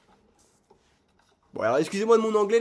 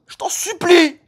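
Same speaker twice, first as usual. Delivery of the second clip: higher, louder begging; desperate plea; 7 semitones higher, 8 dB louder, slower than usual. Real, not acted. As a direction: beg the machine for a win. Begging the slot machine.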